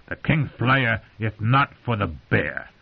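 slow lisping slobbery voice; thick slobbery voice. Acted. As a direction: lisp it through a wet mouth. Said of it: "in that thick, slobbery voice of his".

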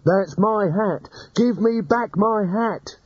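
high, slow indignant demand; petulant high wizard demanding hat. Acted. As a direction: whine for the hat back. Rincewind demands his hat.

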